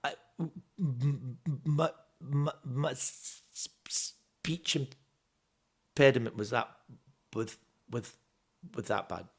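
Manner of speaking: halting, broken demonstration stutter; demo stutter. Shown as a demonstration. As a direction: stutter through it on purpose. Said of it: "I did this in my show".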